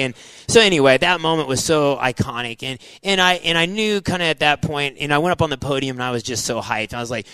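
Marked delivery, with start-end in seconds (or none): none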